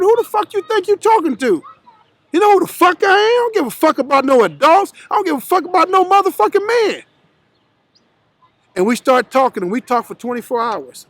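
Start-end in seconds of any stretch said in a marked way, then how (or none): 0.0-7.0 high, mock-furious retold threat; re-live the challenge, pitched up hot; heated threat. Slamming his gun on the table at Jim Brown, in a heated mock threat.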